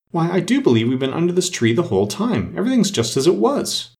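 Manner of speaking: high-pitched astonishment; great surprise. A narrator's reading. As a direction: marvel at it, pitch climbing. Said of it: "Alice looked round her in great surprise".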